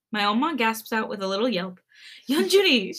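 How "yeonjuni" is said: high, loud delighted exclamation; excited laughing. A narrator's reading. Oma hearing the baby is coming, excited. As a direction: burst out the name in excitement. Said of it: "she laughs and stammers through her words".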